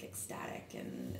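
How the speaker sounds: normal talking voice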